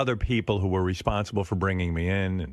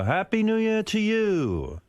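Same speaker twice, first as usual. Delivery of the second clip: imitating Paul; 11 semitones higher, slower than usual. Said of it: "He goes".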